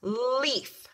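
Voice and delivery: put-on drawn-out leaf, sounded out for kids; stretched modeling word. Shown as a demonstration. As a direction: say leaf slowly so every sound is heard. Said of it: "Let's try it together".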